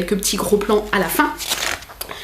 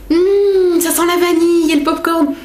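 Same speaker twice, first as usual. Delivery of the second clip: delighted savoring; 6 semitones higher than usual. Real, not acted. Vlogger sniffs vanilla popcorn page, delighted. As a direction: relish the smell, pitch lifted high.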